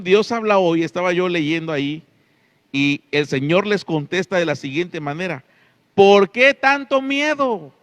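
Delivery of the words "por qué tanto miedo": "¿Por qué tanto miedo?" shouted higher and louder, slow, falling hard on "qué"; Jesus rebuking the frightened disciples, loud and slow; loud rebuke. Acted. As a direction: scold them with the question, high and loud.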